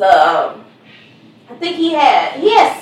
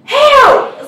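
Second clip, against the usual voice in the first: much higher, louder yelp; cry for help; 12 semitones higher, 4 dB louder than usual. Retold or imitated. Son scared of the frog, yelling for help up high. Son calls mom from downstairs.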